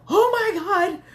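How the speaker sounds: loud, high freaked-out gasp of shock. Retold or imitated. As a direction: cry it out high in alarm. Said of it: "I'm freaked out".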